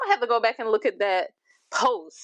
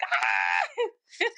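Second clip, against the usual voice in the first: imitated excited scream; 10 semitones higher than usual. Fan re-enacting seeing the cast post, excited mock scream. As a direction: squeal it high, reliving the thrill. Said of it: "I was literally on my phone screaming".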